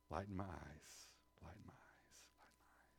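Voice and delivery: low, soft plea fading into a whisper; hushed prayer. Acted. Preacher praying the psalm's line, fading to whisper.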